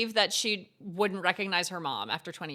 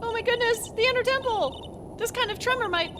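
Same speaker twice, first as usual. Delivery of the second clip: high, urgent voice; alarmed young woman; 10 semitones higher than usual. Acted. Character fearing the temple collapse, high urgent voice. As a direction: sound worried about the temple.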